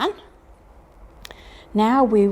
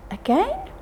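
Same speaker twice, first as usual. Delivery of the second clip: playful question; 13 semitones higher, 4 dB quieter than usual. Real, not acted. Asking the children.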